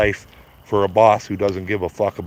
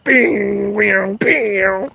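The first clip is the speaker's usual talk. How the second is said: playful bomb noises; 8 semitones higher, much slower than usual. Pretend. Imitating drone bombs dropping.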